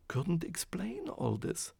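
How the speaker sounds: ordinary delivery, nothing marked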